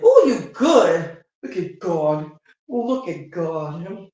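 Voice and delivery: loud, drawn-out praise, easing as it goes; re-enacted church-style thankful exclaiming; grateful praise. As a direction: testify slowly with gusto, starting big. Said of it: "so grateful".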